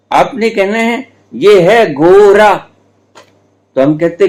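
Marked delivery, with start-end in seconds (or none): 1.3-2.6 lightly voiced teacher, drawn out and higher; slow teacher drill. Guru mimics the teacher drilling the class, slow and high. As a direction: spell it out slowly like a village schoolmaster. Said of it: "लेकिन आपने क्या कहना है?".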